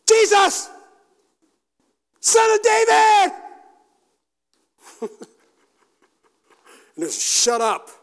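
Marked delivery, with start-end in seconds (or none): 0.1-3.3 high, very loud desperate call; full-voiced yell. Blind beggar calling to Jesus passing by, yelling. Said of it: "So he's, he's yelling". 7.2-7.9 loud, higher-pitched rebuke; sharp silencing shout. Acted. Crowd hushing the blind beggar, sharp shout.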